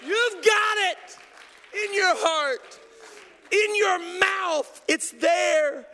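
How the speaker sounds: loud, driving sermon climbing to a high shout; full-force preaching. Real, not acted. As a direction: build relentlessly to a shouted climax.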